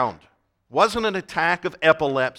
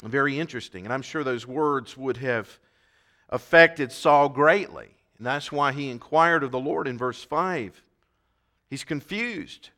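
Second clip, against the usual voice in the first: ordinary talk, nothing marked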